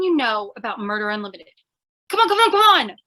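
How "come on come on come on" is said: higher, louder, pressing hard; impatient urging. Acted. Dorothy pressing Piggy to spill it, impatient and louder. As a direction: hurry him along, bursting with impatience.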